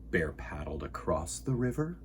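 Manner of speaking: usual speaking voice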